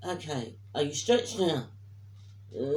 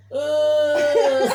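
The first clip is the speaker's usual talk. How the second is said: mock groan; 7 semitones higher, 11 dB louder than usual. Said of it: "And she's gone".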